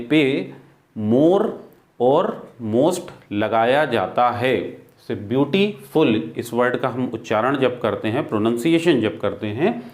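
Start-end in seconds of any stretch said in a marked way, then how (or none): 5.2-6.2 beautiful slowly, slight break before फुल; split into syllables. Shown as a demonstration. Teacher giving an example of syllables, word broken up. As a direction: say beautiful in parts, फुल set apart. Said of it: "यहाँ syllable एक से ज़्यादा है".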